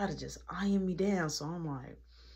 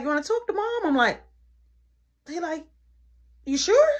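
high teasing little brother; 11 semitones higher, 7 dB louder than usual. Retold or imitated. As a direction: tease it high like a kid.

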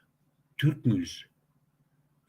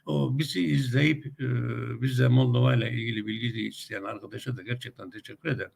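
ordinary delivery

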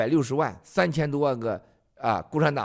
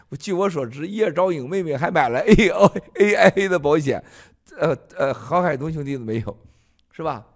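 usual speaking voice

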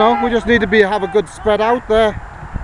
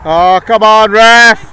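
louder protest at the referee; exasperated call; yelling from the sidelines; 3 semitones lower, 5 dB louder, slower than usual. Real, not acted. Coach complaining to referee.

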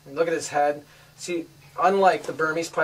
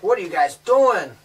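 put-on louder imagined baby's reaction; voicing the baby; 3 semitones higher, 6 dB louder than usual. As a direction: pretend to be the puzzled baby, louder. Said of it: "she's probably like".

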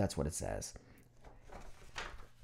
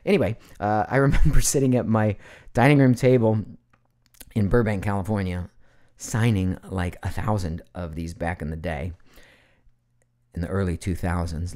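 ordinary talk, nothing marked

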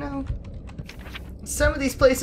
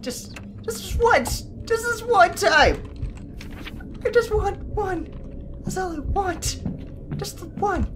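higher, begging whine; pleading whine; 9 semitones higher than usual. Real, not acted. Gamer pleading with the game for one item, high whine.

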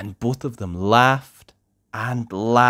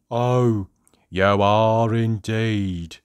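slow, sad squeaky character; sniffing miserably; much slower than usual. A narrator's reading. Miserable lookalike answers the cheerful visitor, slow and sniffy. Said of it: "sniffed the person who looked like".

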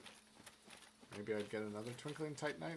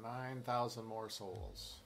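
ordinary talk, nothing marked

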